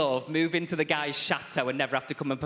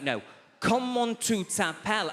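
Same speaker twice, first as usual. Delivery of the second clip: higher, slow, insistent French question; exasperated professor; 7 semitones higher than usual. Retold or imitated. French professor repeating his question to a student, exasperated and higher. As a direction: repeat it slowly, losing patience.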